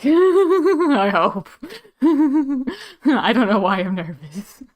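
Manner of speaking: nervous giggling. Real, not acted. Streamer giggling nervously about the game, laughing through words. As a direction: giggle nervously while talking.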